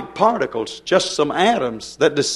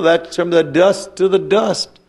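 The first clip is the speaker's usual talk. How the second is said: ordinary talk, nothing marked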